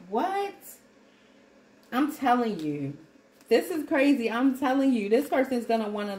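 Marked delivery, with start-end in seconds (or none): 0.1-0.5 high, startled mock what; shocked what. Viewer hearing the reading, high and startled. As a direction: squeak it out like a stunned viewer. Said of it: "you're gonna be like".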